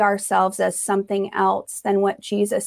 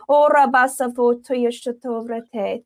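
speaking in tongues; 3 semitones higher than usual. Acted. Praying in tongues.

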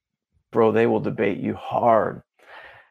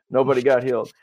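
ordinary talk

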